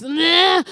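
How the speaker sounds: loud, high protesting groan; grumpy groan. Retold or imitated. Jenny throws herself out of bed, with a grumpy groan. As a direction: imitate a sleeper groaning at being woken. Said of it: "sometimes she does this".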